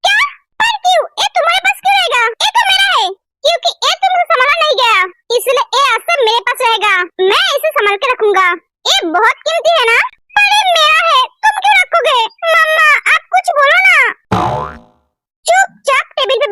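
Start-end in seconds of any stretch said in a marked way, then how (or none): 0.0-3.1 fast, high, shouting protest; upset protest. Acted. Sister shouting at her brother over her pendant, high and fast. 10.3-14.0 shouting protest. Acted. Sister pleads, shouts at brother.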